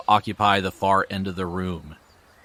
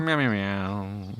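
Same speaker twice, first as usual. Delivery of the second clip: cat meowing; slower than usual. Pretend. Cat noises, slower.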